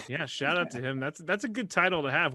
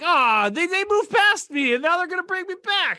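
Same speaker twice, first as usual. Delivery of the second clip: much higher, louder mock whine; high, loud mock voice of a hurt player; imitating Carson Wentz; 13 semitones higher, 8 dB louder than usual. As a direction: play the jilted quarterback, hammed-up. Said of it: "and he's like".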